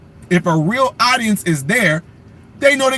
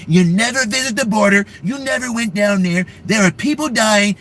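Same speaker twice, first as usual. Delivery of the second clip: impersonating Trump attacking. Retold or imitated. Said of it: "Trump will be eating her up".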